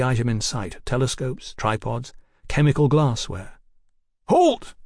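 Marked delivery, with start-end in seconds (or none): 4.3-4.7 sharp, high-pitched command shout. Acted. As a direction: shout to stop them in their tracks. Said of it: "Fraser shouted".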